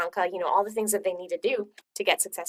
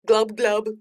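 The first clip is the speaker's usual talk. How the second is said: fish glub noises; 6 dB louder than usual. Acted.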